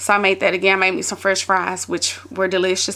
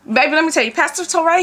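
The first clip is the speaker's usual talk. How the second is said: usual speaking voice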